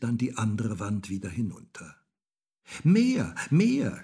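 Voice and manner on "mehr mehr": put-on high, loud child's demand; shouting. A narrator's reading. Häwelmann in his rolling bed demanding more, shouting. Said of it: "schrie Häwelmann".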